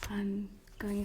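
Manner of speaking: hushed words to herself, barely voiced; whispered aside. Real, not acted. Speaker checking her notes mid-talk, whispering to herself. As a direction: whisper it under your breath while scanning notes.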